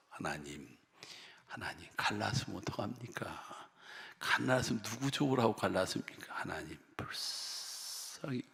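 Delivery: soft, hushed pleading prayer; quiet sorrowful prayer; tearful prayer. Retold or imitated. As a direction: whisper a tearful plea to God. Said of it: "울면서 한 달을 기도해서".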